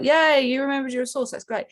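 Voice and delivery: cheery source voice. Retold or imitated. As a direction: cheer like a delighted friend. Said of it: "Source is like".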